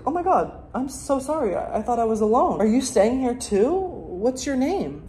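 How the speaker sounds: high-pitched, coy apology to a stranger; flustered small talk. Pretend. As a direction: play shyly startled by unexpected company. Acts meeting a stranger in bath.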